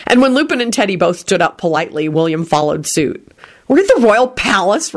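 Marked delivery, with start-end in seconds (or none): 3.7-4.9 a touch of sulk, pitched up; sulky thought. A narrator's reading. Rosie watches Beatrice arrive, mildly sulky. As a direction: think it sulkily. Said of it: "Rosie thought sulkily".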